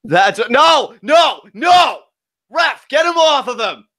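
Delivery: high, loud outraged yelling; alarmed shouting. Real, not acted. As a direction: yell at the ref to step in. Yelling at the referee during match.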